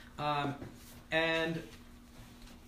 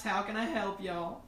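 bright, louder southern-tinged shop greeting; shopkeeper greeting; 7 semitones higher, 6 dB louder than usual. Acted. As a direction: greet customers cheerfully, drawing out y'all. GM voices shopkeeper Molly.

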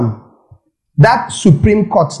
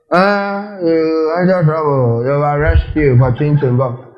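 mock droning voice. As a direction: act out a droning gum chewer. Acting out someone caught chewing gum.

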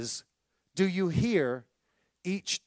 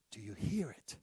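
softer, lower, barely voiced; hushed invitation to listen; hushed question; 8 semitones lower, 14 dB quieter than usual. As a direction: draw him in with a hush.

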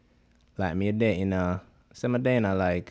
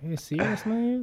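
high, astonished are you serious man; incredulous; 13 semitones higher than usual. Real, not acted. Reacting to the friend's story, high incredulous disbelief.

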